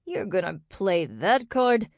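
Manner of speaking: slow, indignant snarled question; snarling disbelief. A narrator's reading. Xander challenging Kendrick on the phone, snarling. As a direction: snarl it slowly, incredulous. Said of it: "Xander snarled".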